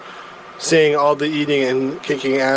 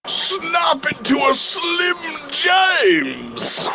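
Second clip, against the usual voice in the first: much higher, slow shouted wrestler promo; British Randy Savage shout; 10 semitones higher, slower than usual. Retold or imitated. Comic shouting a British Randy Savage catchphrase. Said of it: "my impression of a British Randy Savage".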